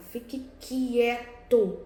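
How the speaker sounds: very slow, with quieto stretched and pressed; teacher demonstrating emphasis on quieto; stress on quieto. Shown as a demonstration. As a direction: drag out Fique quieto, leaning hard on quieto. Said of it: "Eu dei uma ênfase no quieto".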